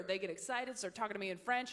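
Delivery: ordinary talk, nothing marked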